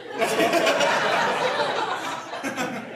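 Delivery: high burst of real laughter. Real, not acted. Preacher laughing at his own story. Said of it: "Oh, really?".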